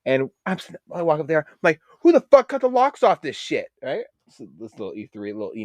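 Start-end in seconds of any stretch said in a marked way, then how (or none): 2.0-3.7 high, louder mock yell; retold yell. Sailor demanding who cut the locks, mock yell. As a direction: belt out the question in acted indignation.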